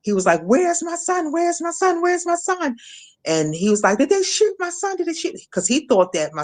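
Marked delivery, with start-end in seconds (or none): 0.4-2.8 high, frantic repeated cry, mildly retold; irate husband. Irate husband calling out for his missing son, high frantic cry. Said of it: "like, so irate". 4.0-5.4 high frantic question, lightly imitated; panicked husband. Husband fearing his son was shot, high panicked pleading. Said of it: "like, so irate".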